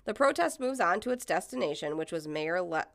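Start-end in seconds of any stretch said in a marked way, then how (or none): none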